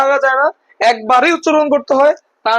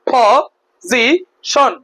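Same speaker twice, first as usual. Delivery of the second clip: Position in three separate beats, po-zi-shon; position very slowly, a gap after each syllable; much slower than usual. Shown as a demonstration. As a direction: break position into three slow syllables with pauses. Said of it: "মোট কয়বার বলো তো?".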